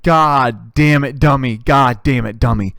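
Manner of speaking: loud, slow imitation of an angry dad; mad dad. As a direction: curse out each word like an exasperated father. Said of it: "He's so mad".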